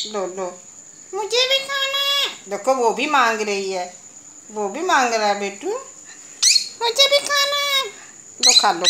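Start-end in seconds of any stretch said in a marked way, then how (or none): 1.1-2.3 much higher, small pleading pet voice; squeaky little beggar asking for a bite; begging pet voice. Pretend. Voicing a pet begging food. 6.8-7.9 much higher, small pleading pet voice; squeaky little beggar asking for a bite; begging pet voice. Pretend. Voicing a pet begging food.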